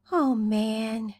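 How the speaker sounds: usual speaking voice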